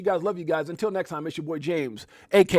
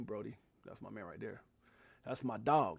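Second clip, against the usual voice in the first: ordinary talk, nothing marked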